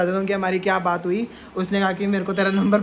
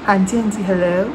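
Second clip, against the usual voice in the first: fake Harleen Kaur. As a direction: play a polite Punjabi woman calling a stranger. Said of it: "ना कि कोई और हरलीन कौर".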